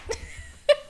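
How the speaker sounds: airy, very high burst of laughter; breathless high laugh. Real, not acted. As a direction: let a breathless laugh escape. Laughs at the punchline.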